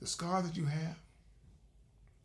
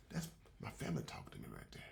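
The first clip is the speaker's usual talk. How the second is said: whisper; 9 dB quieter, faster than usual. Retold or imitated. Hearing family's voice inside.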